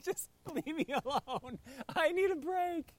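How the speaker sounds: high, whining plea for rest; petulant whine for a break; whiny plea. Retold or imitated. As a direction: beg to be left alone. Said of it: "That's how I would respond".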